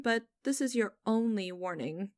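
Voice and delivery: ordinary talk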